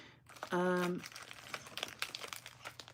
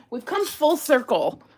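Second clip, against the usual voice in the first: higher and louder, laughing through the words; 8 semitones higher, 9 dB louder than usual. Real, not acted. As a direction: laugh at the irony. Laughing at finding bracelets.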